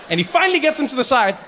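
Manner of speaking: ordinary talk, nothing marked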